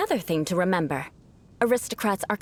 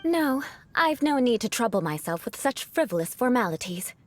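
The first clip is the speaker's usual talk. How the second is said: usual speaking voice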